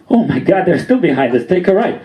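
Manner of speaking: high mock alarm; panicky cousin. Cousin sees cops still following, mock-alarmed.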